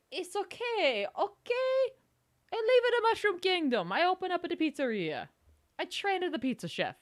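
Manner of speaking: high, hammed-up Italian plumber voice; Mario. Streamer voices retired plumber explaining himself, crappy mock Mario. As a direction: put on a bad Italian-American pizzeria owner. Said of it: "look at retired Mario".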